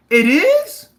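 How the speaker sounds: high, loud questioning disbelief; mock surprise. Streamer answering a viewer's jab, in loud mock surprise. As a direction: feign shock at the news, pitched right up. Reacting to teasing chat comment.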